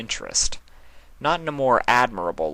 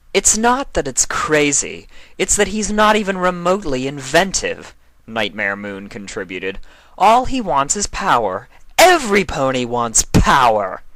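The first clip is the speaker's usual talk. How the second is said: scornful dismissal; 6 semitones higher, 5 dB louder than usual. A narrator's reading. Nightmare Moon scornful of Sombra.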